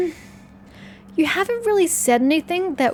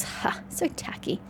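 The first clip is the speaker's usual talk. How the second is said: scoff; 5 semitones higher, 9 dB quieter, slower than usual. Acted. Scoffing at flirting line.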